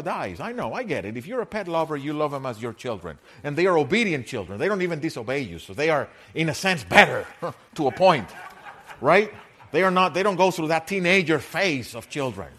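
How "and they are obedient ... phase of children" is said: loud, quick, lively sermon voice with laughter; animated preaching. Acted. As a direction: push through it with a grin. Preaching at full force.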